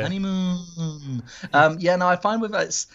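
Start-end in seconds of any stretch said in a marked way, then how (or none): 0.0-1.2 long, high, falling "honeymoon"; drawn-out echo. Acted. Guest echoes the host's honeymoon, drawn-out swoon. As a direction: stretch it out slowly and let it glide down. Echoing the other's drawn-out word.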